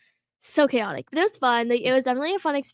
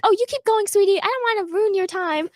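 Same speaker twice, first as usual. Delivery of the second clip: sugary coach; 8 semitones higher than usual. Retold or imitated. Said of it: "she's like".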